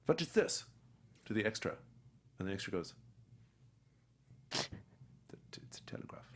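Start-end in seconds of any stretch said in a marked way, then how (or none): 0.0-0.6 put-on higher German-accented question; Anthony Hopkins. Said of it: "he has this German accent". 4.5-6.2 faint, halting whisper; timid whisper. Retold or imitated. Nervous extra's botched first line.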